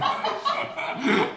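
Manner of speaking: breathy burst of laughter. Real, not acted. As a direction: laugh out at the memory. Teacher laughs at own story. (숨섞인 웃음)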